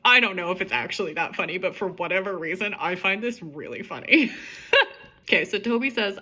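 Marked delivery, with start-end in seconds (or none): 0.6-4.4 giggling through the words; real amusement breaking into laughter; laughing. Real, not acted. Reader cracking up at her own old story, laughing. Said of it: "this one cracks me up".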